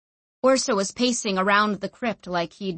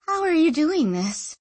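higher-pitched, wondering question; awed; 8 semitones higher than usual. A narrator's reading. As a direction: ask it in mild awe.

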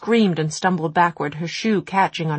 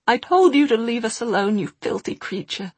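higher, hard-breathing anger at the goblin; breathless fury; 5 semitones higher than usual. A narrator's reading. Jessamyn attacking the goblin, breathless and furious. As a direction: spit it out between hard breaths. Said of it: "She was breathing hard".

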